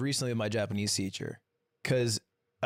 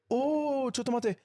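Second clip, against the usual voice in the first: high startled Japanese "chotomate"; imitating the teacher; 10 semitones higher, much slower than usual. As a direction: squeak it like a flustered teacher. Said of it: "and he goes".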